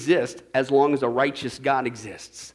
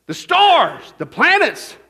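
high, booming pulpit shout; loud, rising sermon cry; full-force preaching; 11 semitones higher, 13 dB louder, much slower than usual. Acted. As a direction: hammer each item out like a fiery preacher.

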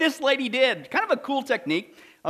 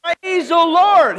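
slow, loud, high joyful shout; 7 semitones higher, 9 dB louder, much slower than usual. Retold or imitated. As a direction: jump for joy as you shout it. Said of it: "she said".

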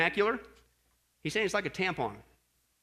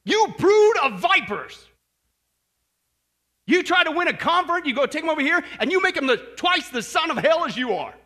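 furious rebuke; 8 semitones higher, 10 dB louder than usual. Retold or imitated. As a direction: thunder it like Jesus ripping into the Pharisees. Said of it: "it all has exclamation points".

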